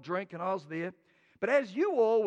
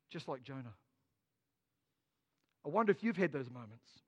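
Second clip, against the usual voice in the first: ordinary delivery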